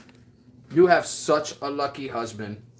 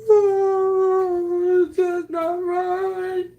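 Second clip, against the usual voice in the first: mock wail; 5 dB louder, much slower than usual. Fake crying after "don't make me cry.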